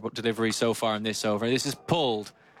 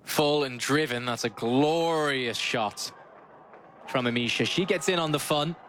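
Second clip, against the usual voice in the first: excited cricket commentary; 4 semitones higher than usual. Real, not acted. Commentator hailing Amisha's first boundary, excited play-by-play. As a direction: stretch the praise and lift through the call.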